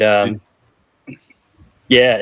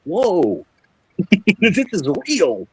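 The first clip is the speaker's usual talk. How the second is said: amazed; 5 semitones higher, much slower than usual. Retold or imitated. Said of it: "You're like".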